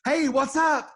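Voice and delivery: chirpy wife calling mid-shopping; cheerful wife asking about his day; wife on the phone. Retold or imitated. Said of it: "She calls and she says".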